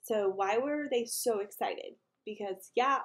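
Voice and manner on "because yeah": usual speaking voice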